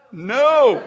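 loud, drawn-out mock refusal; comic flat-out refusal, blurted loud; Kim refusing. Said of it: "What does Kim say?".

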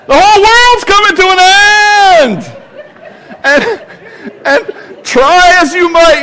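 mock end-of-the-world parental panic; frantic parent. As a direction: ham up a parent's blanket panic. Young parents panicking over blanket.

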